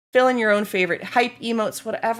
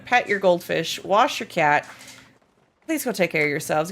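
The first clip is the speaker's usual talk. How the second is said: normal talking voice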